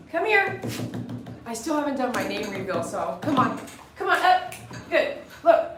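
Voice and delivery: normal talking voice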